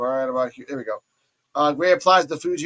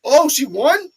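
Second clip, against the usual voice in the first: loud, high, surprised cries; shocked delight; 11 semitones higher, 9 dB louder, slower than usual. Retold or imitated. Kendall seeing Carly win, loud and amazed. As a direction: gasp it out in disbelief. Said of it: "She's like".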